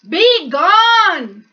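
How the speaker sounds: loud, high furious command; roar. Acted. Bear roaring at the mouse to leave. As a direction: blast him out the door. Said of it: "roared the bear".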